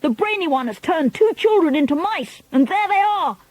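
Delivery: high, excited witch shrieks; shrieking crowd of witches; excited cries. A narrator's reading. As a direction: cry out in alarm, pitched high. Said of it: "crying out".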